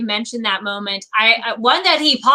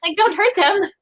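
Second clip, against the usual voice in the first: higher, laughing plea for the dragons; mock pleading; 9 semitones higher than usual. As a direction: beg them not to hurt the cute animals. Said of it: "but I'm still like".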